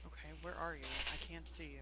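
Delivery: soft, hushed voice; whisper. Acted. Amanet searches for friends hidden in grass, hushed whisper. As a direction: call to them without being overheard. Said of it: "Amanet's gonna whisper".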